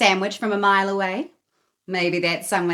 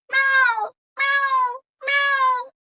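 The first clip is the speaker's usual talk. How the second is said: very high, louder miaow; demanding cat meow; 5 dB louder, much slower than usual. A narrator's reading. Greedy Cat demanding food from Katy, high loud meows. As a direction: cry like a hungry cat. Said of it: "Greedy Cat cried".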